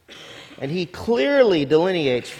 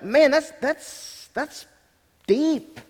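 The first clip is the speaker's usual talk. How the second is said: higher, awed exclamation; a touch of awe, pitched higher; amazed; 8 semitones higher, much slower than usual. Retold or imitated. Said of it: "I was like".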